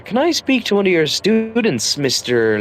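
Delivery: lightly acted high-pitched principal; mock-polite request, pitched well up; imitated principal. Acting principal addressing the teacher.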